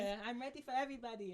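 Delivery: high, put-on interview voice; high-voiced nice-person imitation; sweet fighter impression.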